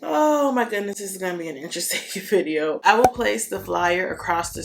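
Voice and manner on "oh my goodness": ordinary delivery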